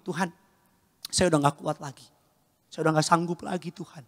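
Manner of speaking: soft, subdued confession; weary prayer. Acted. Believer telling God they cannot go on, quietly spent. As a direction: pray it quietly, as if worn out. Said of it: "Doa sama Tuhan ngomong begini".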